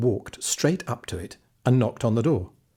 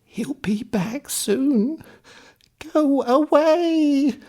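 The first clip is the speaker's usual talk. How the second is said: put-on high, very slow old crone voice building louder; anxious old woman; 4 dB louder, much slower than usual. Old woman urging the stranger to leave before the giant returns. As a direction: shoo him off, drawn out and urgent.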